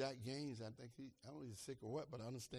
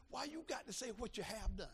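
ordinary talk, nothing marked

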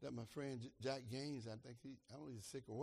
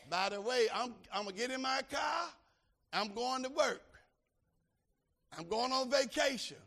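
louder, higher mock of a sassy person; mock sassy churchgoer; 9 semitones higher, 11 dB louder than usual. Pastor mocking a boastful churchgoer, sassy and loud. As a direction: lightly play someone talking back to God. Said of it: "You take your little bony finger".

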